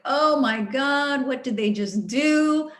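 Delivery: re-enacting being appalled, slowly; acted outrage. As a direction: stretch it out in mock disbelief. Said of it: "when I am just outraged".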